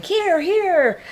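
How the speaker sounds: put-on high eager calls to the kids; tourists calling out. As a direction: call down from the rail.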